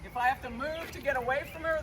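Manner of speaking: normal talking voice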